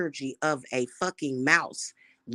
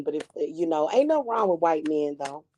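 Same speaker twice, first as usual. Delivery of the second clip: ordinary talk, nothing marked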